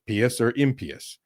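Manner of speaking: pious as PEE-us, impious as IM-pee-us; long e in both pious and impious; Latin pronunciation. Shown as a demonstration. Showing the old way, pious and impious said with PEE. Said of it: "So you would say".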